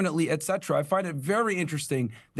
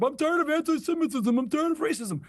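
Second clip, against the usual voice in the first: high, whiny mock of the rabbi's words; a sneering high parody of the rabbi; mocking impression of rabbi; 9 semitones higher than usual. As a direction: parrot him back high and sneering.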